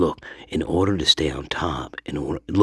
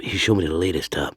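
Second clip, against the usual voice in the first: ordinary talk, nothing marked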